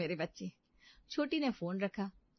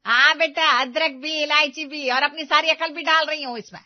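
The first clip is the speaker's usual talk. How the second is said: sarcastic retort; 7 semitones higher, 12 dB louder than usual. Acted. Mother answers sarcastically, banging ladle.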